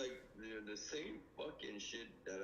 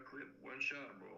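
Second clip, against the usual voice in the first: usual speaking voice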